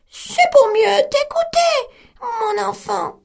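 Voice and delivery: the wolf squeaks like grandmother; wolf in bed faking the old woman; small voice. Acted. Wolf as granny.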